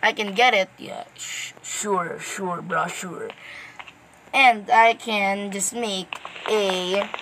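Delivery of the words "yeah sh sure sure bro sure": muttered, sarcastic and much softer, fading almost to a whisper; sarcastic sure bro. Real, not acted. Gamer mutters sarcastically at the game, softly. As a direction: brush it off under your breath.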